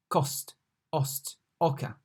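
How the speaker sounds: cost, ost, oca with one open O; comparison demo. Shown as a demonstration. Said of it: "pronounced like O in cost".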